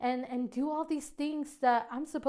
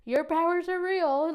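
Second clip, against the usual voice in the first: loud, raised, awestruck outburst; amazed exclamation; 5 semitones higher, 7 dB louder than usual. Retold or imitated. Wife to God after husband cried, amazed. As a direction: retell it with emphatic wonder.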